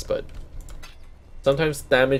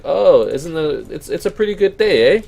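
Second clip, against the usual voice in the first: Canadian small talk; 5 semitones higher, 6 dB louder than usual. Retold or imitated. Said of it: "that's what Canadians say".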